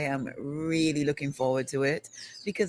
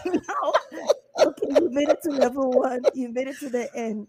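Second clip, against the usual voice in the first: high, breaking into giggles; words squeezed out through laughter; trying not to laugh; 9 semitones higher, 4 dB louder than usual. Real, not acted. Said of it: "I'm trying not to laugh".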